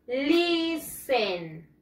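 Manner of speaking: listen drawn out in two long syllables, "liii-sen"; correct pronunciation. Shown as a demonstration. Teacher models for students to repeat, long "liii-sen". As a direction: stretch listen slowly, leaving the T silent. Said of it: "The pronunciation is".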